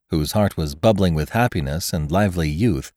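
plain reading voice

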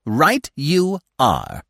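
high, very drawn-out words; chattering squirrel. A narrator's reading. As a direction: stretch each word in a squirrel voice. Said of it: "he chattered on".

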